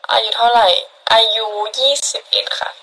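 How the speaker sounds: ordinary delivery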